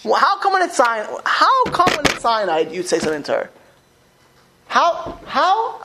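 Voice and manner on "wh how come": higher and louder, in disbelief; indignant exclaim. Real, not acted. Rabbi protesting a student's answer, raised and incredulous. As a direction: throw it back at them, incredulous.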